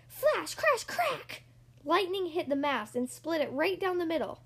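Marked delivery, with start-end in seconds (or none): 0.1-1.4 very high, explosive words; sudden high exclamations; dramatic storm. A narrator's reading. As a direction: burst out each word like lightning. Storm sound words in story.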